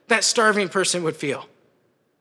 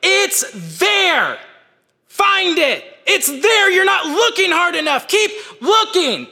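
yelling; 10 semitones higher, slower than usual. Retold or imitated. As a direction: yell it up the stairs, relentless. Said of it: "I just keep yelling".

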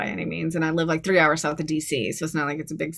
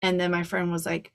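ordinary talk, nothing marked

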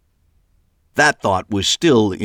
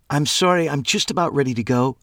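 lightly voiced woman, higher; a hint of a higher female voice; Trish voice; 7 semitones higher than usual. A narrator's reading.

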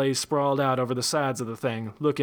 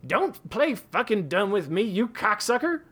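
furious; 9 semitones higher than usual. A narrator's reading. Daggett furious.

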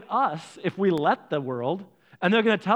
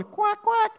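imitated duckling quack; short mock quacks; duck quacks. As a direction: quack like the other ducks. Contrasts ducks' quack with honk.

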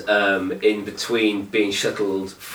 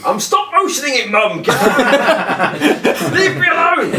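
high-pitched hammed-up whining yell, building to a louder peak; mock teenage tantrum; 12 semitones higher, 5 dB louder than usual. Sulky kid yelling at mum about stop motioning, hammed-up tantrum. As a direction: play a stroppy kid shouting at mum.

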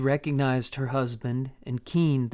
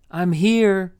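loud call to the men outside; 6 semitones higher, 7 dB louder than usual. A narrator's reading. Rebecca answering the men outside, loud call. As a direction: call it out through the window. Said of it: "she called to the men outside".

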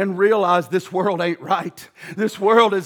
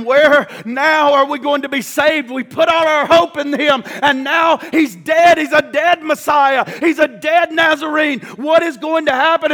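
anguished cry; 5 semitones higher, 4 dB louder than usual. Acted. Disciples voiced at the cross.

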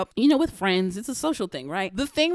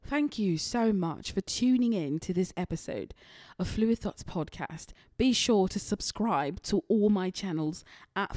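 much softer, put-on British accent; 6 dB quieter than usual. Host signing off to listeners in a soft British accent. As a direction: sign off like a posh Brit. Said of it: "And this is my British".

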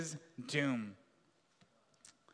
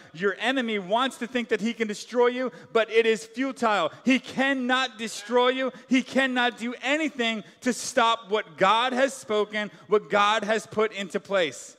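louder, rising preacher's declaration; preaching at full force; 4 semitones higher, 6 dB louder than usual. Real, not acted. Preacher assuring believers, forceful and loud. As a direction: drive it home to the congregation.

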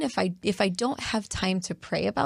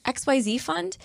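lightly voiced cashier, pitched up; higher-pitched mock donation request; checkout clerk asking; 9 semitones higher than usual. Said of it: "the person asks you".

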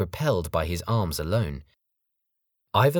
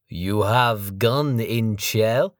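put-on slow, higher, louder suspicious accent; suspicious foreign thug; 4 semitones higher, 5 dB louder, much slower than usual. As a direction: probe it with suspicion. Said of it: "said the Mongolian suspiciously".

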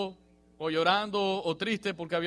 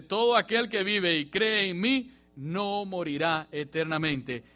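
slow, weighty and louder, each word laid down; solemn slow proclamation; 2 semitones higher, 5 dB louder, slower than usual. Acted. Preacher to his church, voicing Jesus' promise, slow and weighty. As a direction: proclaim it slowly like a sacred promise.

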